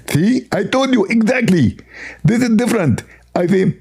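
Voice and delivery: high, squeezed, spitting lisp; Zakir Naik. Acted. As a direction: squeeze the words out with a spray of spit. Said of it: "I speak this way only when".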